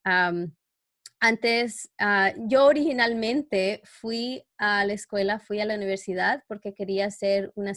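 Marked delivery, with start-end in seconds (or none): none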